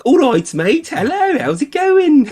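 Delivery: very high, loud cartoon Brummie; sing-out friendly mock Birmingham accent. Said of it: "But in Birmingham, when you go there".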